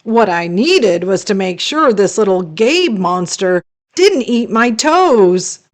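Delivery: plain reading voice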